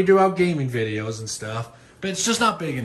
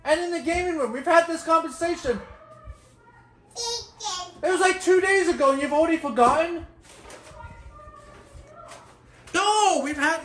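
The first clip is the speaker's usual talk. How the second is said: angry scolding; 12 semitones higher than usual. Real, not acted. Father argues with children over food.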